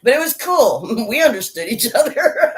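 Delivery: high, loud talk breaking into laughter; laughing. Real, not acted. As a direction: laugh through a fond recollection. Still laughing at the memory.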